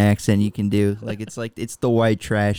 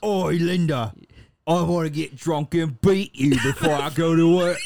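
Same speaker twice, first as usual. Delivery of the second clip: higher, thick Cockney husband; Cockney accent; 4 semitones higher than usual. Acted. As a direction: say goodbye in hammed-up trashy Cockney. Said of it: "say goodbye to your wife in Cogni".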